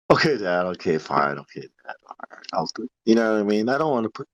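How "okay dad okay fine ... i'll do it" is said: low, trailing-off acted compliance; reluctant son. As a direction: give in, sinking down to a near-soundless mumble. Son giving in to his dad.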